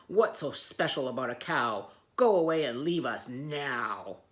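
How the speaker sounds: loud, growling dismissal; gruff lions. Acted. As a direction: growl them away. Lions send her away.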